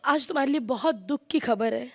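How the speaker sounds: low, soft mock condolence; mock sorrowful. Woman breaks news of her wedding to Sohail, mock sorrowful.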